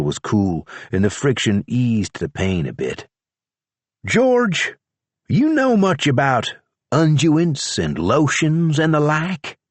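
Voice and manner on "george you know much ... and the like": higher, lighter voice for Charlie; young deputy asking a question; Charlie's voice. A narrator's reading. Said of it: "he said".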